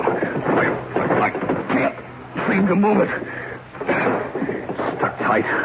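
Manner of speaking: a hint of effort, slow and breathless; straining. Man straining at a stuck window, breathy effort. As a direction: push against the stuck window.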